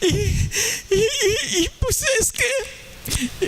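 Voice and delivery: can't get words out for laughing; laughing and crying. Real, not acted. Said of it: "yo revolcándome ahí de risa y de llorando". (risa incontenible con lágrimas)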